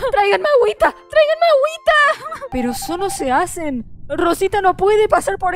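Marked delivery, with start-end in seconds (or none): 0.1-0.9 quick dramatic call for water; fast fainting plea. Acted. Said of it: "Se desmaya". 0.9-2.5 high, hammed-up swooning cry; fainting diva. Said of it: "Se desmaya".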